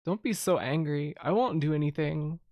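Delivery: normal talking voice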